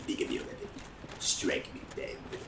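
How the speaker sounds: whispered sinister taunt; villain whisper. Pretend. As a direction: hiss it like a tempting villain.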